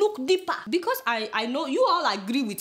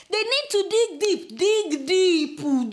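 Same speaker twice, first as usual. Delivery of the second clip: slow, raised-pitch insistence; emphatic urging; 6 semitones higher, 4 dB louder, much slower than usual. Real, not acted. Emphatic urging to investigate further.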